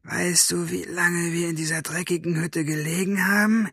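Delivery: high, hissed villain voice; hissing villain. A narrator's reading. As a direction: hiss the complaint at her. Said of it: "zischte er Maggie an". (hohe, zischende Schurkenstimme)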